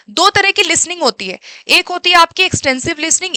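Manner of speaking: ordinary talk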